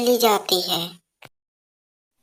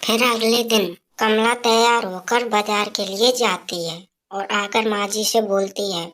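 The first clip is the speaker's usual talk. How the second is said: usual speaking voice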